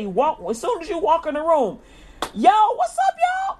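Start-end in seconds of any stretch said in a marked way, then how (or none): 2.3-3.6 high, loud hype hello to the class; big cheerful "yo" to the class; excited greeting. Pretend. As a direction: call out to the room all pumped up.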